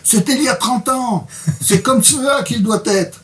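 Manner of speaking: high-pitched, insistent patient; Andy protesting. Acted. Reader voices patient arguing over old injury, high protest. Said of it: "alors ça, c'est Andy qui parle".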